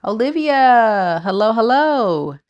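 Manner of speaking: high, loud sing-song hello; excited greeting. Real, not acted. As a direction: call out a happy welcome. Greeting a viewer in chat.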